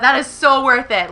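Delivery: loud excited declaration; gushing that it was worth every cent; freaking out. Real, not acted. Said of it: "now that I've done freaking out".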